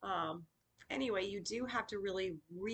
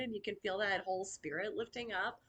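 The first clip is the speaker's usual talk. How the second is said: ordinary delivery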